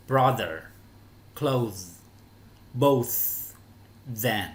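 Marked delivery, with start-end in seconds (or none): 0.1-3.1 Brother, clothes, both, each apart with clear th; voiced th in Brother and clothes, voiceless in both; isolated falling words for learners; th pronunciation demo. Shown as a demonstration. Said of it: "hai cái chữ đầu tiên đọc là âm the".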